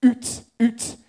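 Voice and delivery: high, loud Scottish "oot"; mock Scottish accent. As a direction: mimic the Scots with a rounded, punched "oot". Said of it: "I used to copy".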